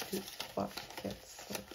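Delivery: soft, half-voiced count to oneself; counting under breath. Real, not acted. Vlogger checking her savings challenge, counting under her breath. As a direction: count quietly, barely voicing the numbers.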